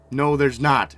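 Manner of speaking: sharp loud denial. Acted. Daughter flatly denies.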